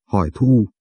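usual speaking voice

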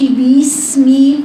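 باسمي stretched with an added ya; overdone kasra. Shown as a demonstration. Teacher warns against over-stretching the kasra. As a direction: exaggerate بسم into باسمي. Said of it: "ما أقولش".

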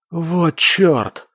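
a touch of a husky, alarmed exclamation; hoarse mutter. A narrator's reading. Oleg realises an avalanche is coming, hoarse, alarmed mutter. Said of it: "пробормотал он осипшим голосом".

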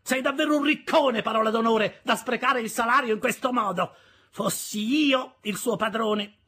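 loud, high-pitched tirade; indignant scolding. A narrator's reading. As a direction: rail loudly at a foolish husband. Sister rails at Joe's generosity.

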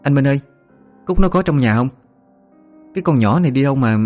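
calling from the yard. A narrator's reading. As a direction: call out across the yard. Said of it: "Chợt ngoài sân có tiếng nói".

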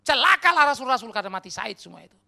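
loud, high start, tailing down; sarcastic sweeping pronouncement; mock condemnation. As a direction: ironic outrage at full voice.

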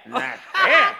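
high, loud laughing disbelief; incredulous squeal. Acted. A servant repeats the riddle with a high laughing squeal.

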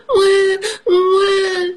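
high wailing mock cry; baby mouse crying. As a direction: wail like a little lost baby. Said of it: "he cries".

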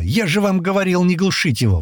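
high, exasperated call; shouted complaint. Acted. Миха tells off the others from the wheel, shouting. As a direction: scold the others from a distance. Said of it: "громко крикнул с первого джипа".

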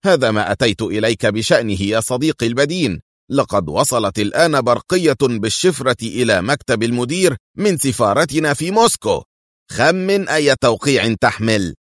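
usual speaking voice

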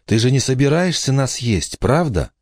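put-on high child's voice, first asking; girl asking warily. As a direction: ask the giant in a high little-girl voice. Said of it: "Я сказала".

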